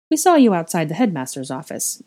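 usual speaking voice

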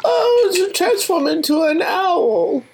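high, slow put-on voice; silly high voice. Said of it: "That's not his voice".

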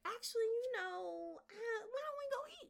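a squirming change of subject; awkward deflecting husband. Retold or imitated. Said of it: "And he was like".

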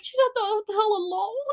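high-pitched incredulous question; imitated alarmed mom. Mom learning friend is alone, imitated alarmed disbelief.

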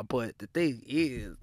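ordinary delivery

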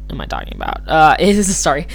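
normal talking voice

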